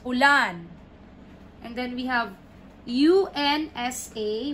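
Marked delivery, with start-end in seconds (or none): 0.0-0.7 "Ulan" quick and loud on LAN; quick final stress. Shown as a demonstration. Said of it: "You have to read it fast".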